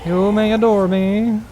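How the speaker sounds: high, hammy diva proclamation; silly grand voice. Acted. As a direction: play it up like a vain queen. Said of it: "I'm being silly now".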